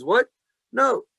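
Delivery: sudden high-pitched "What? No."; a loud, high cry of disbelief; the Torah flatly rejecting gloating, high and loud; shocked refusal. Retold or imitated. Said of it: "Comes along the Torah and says".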